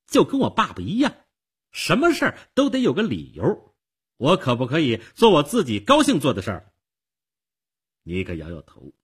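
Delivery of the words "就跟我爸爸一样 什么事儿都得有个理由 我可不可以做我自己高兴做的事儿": high, loud, rapid exasperation; fed up. A narrator's reading. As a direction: complain like a girl sick of being questioned. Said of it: "少女厌烦地说". (不耐烦地抱怨)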